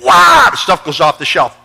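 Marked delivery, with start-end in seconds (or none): 0.0-0.5 very high, loud mock scream; imitated high, loud shriek; woman screaming. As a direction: let out a wild cry. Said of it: "And she says".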